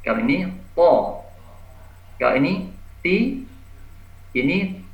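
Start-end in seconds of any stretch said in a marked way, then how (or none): 0.8-1.3 "po" as a thick Arabic emphatic T, rounded toward "tho"; heavy tha. Shown as a demonstration. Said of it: "ini terangkat". 3.0-3.4 "ti" with a light, thin T. Shown as a demonstration. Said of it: "kalau ta ini tipis".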